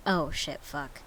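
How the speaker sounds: usual speaking voice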